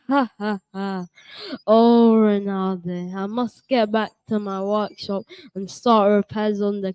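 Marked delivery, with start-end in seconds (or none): 0.1-1.0 soft chuckle fading away; a gentle old man's chuckle; laugh. Acted.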